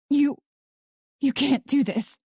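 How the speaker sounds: high, strained, halting protest; breathless attempt at defiance; hoarse croak. A narrator's reading. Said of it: "little more than a hoarse croak".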